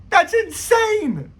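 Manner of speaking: high, slow, amazed exclaim; drawn-out, high-pitched disbelief. Real, not acted. Vlogger marvelling at Moroccan talent, high and slow.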